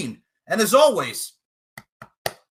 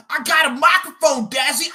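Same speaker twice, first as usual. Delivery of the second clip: high, loud mocking yell; taunting shout; 9 semitones higher, 7 dB louder than usual. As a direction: hurl it at the opponent.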